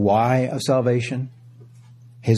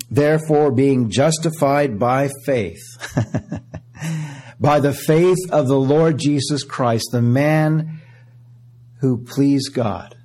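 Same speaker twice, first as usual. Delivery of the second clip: raised, ringing scripture reading; preaching scripture; 3 semitones higher than usual. Acted. Preacher reading Romans to the church, ringing. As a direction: proclaim the verses to the congregation.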